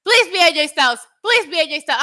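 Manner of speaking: high, loud begging at a screen; screaming fan plea. Retold or imitated. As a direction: re-enact an excited fan's desperate shouting. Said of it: "I'm screaming at my monitor".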